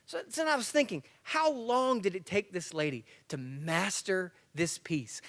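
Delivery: ordinary delivery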